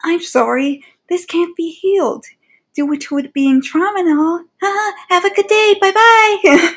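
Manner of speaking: high, sassy robotic brush-off; mock the machine's chirpy dismissal. Machine sassing Pink Pearl, in a high sassy voice. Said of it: "the machines act sassy".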